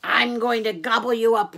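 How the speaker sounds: loud, menacing troll threat; troll's roar. A narrator's reading. Troll threatens Little Gruff, loud roar. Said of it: "roared the troll".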